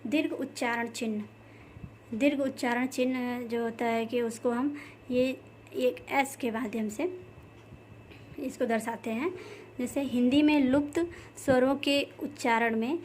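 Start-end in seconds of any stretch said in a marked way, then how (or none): none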